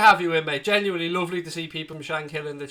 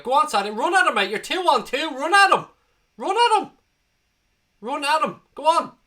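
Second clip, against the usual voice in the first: repeated urgent cries, much higher and louder; urging; 9 semitones higher, 6 dB louder than usual. Real, not acted. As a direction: urge him on at the screen. Urging the player on screen.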